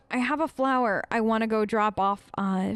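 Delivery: usual speaking voice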